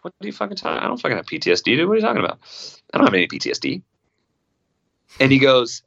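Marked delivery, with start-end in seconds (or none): 0.0-3.8 incredulous denial. Retold or imitated. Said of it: "And I go".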